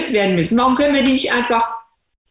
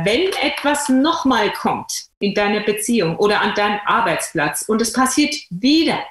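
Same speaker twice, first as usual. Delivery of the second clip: ordinary talk, nothing marked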